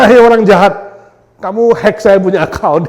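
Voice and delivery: loud, high indignant scold; mock scolding. Believer scolding the hacker, loud mock anger. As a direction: tell off the hacker in a hammed-up huff.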